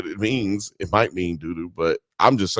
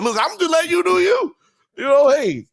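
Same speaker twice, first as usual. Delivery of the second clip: sly shrug-off; 5 dB louder than usual. Retold or imitated. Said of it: "he's like".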